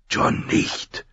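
hushed, voiceless warning; anxious whisper. A narrator's reading. Pip holds Jon back from Thorne, whispering anxiously. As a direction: whisper it urgently to a friend. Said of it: "flüsterte der kleine Junge".

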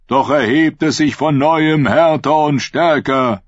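preaching prophet. Acted. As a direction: proclaim it like a fervent prophet. (Prophet predigt laut und langsam zu seiner Schule)

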